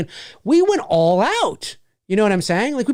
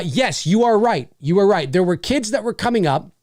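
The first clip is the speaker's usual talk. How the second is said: normal talking voice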